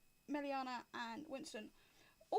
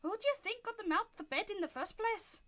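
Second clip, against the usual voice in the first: higher-pitched, affronted tiny piper; indignant little man; 8 semitones higher than usual. Acted. Piper rebuffs Winston's offer to tune, indignant little man. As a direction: pull away and demand it, affronted. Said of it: "very deliberately pulls away and goes".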